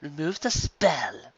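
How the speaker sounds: cold order. Acted. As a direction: order it coldly and slowly. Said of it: "ordered Carrot Top".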